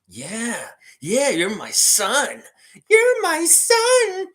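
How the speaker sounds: hammed-up beaver puppet crooning to his son. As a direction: stretch it out like a silly hand puppet. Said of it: "entertain you with the beaver puppet".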